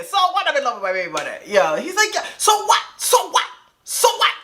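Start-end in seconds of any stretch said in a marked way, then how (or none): 0.0-1.4 family's mock "so what". Family retelling the old joke, mock defiant. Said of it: "We all say". 2.1-4.3 put-on high, heavy-accented "So what?"; thick accent. He repeats it defiantly on speakerphone, thick accent. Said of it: "his accent came out thick".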